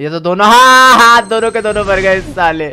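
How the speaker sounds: loud gleeful outburst; excited shout. Real, not acted. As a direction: cheer the double kill. Double kill with landmine.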